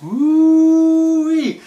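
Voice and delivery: high, loud, long-held "woo-wee"; mock whooping. Host reacting to the jab at absent Lesnar, mock whoop. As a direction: let out a drawn-out high whoop.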